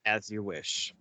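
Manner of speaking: low, soft, understated farewell; Peter Falk's tender sign-off. Retold or imitated. Fan quoting the grandfather's last bit, low and soft. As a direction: drop low and hushed, gently. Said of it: "the last bit by, by Peter Falk".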